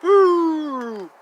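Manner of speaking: a high, loud gleeful woo; excited whoop. Acted. Reader cheering at the star pictures, high whoop. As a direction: let out a big happy whoop.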